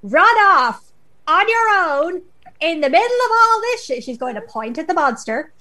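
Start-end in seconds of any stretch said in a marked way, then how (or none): 0.0-4.0 high, loud furious scolding; scolding mother. Acted. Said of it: "brandish my crook at him and be like".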